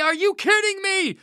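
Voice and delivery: very high, delighted "are you kidding me?"; "are you kidding me?" as a joyous shriek; overjoyed yell. Acted. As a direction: squeal "kidding" in happy disbelief. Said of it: "Naaman yelled".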